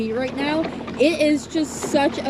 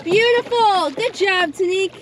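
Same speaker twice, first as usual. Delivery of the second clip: excited cheer; 5 semitones higher than usual. Real, not acted. Cheering a friend's catch.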